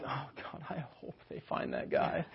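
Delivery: breathless whisper of guilty hope; whispered inner thought. Retold or imitated. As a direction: whisper it like a kid hiding a secret. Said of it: "And I am like".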